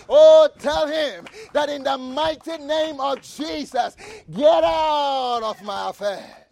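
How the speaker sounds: rising, pounding sermon cadence with repeated hammer lines; fiery preaching. Acted. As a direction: preach it hard, building to each punch. Preacher at full force.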